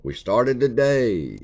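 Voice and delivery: newscaster voice. Shown as a demonstration. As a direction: announce it like the evening news. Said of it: "Try to sound like a newscaster".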